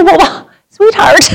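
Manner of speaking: slow, high soothing sweetheart; tender endearment. Retold or imitated. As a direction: comfort a crying stranger. Consoling the crying woman.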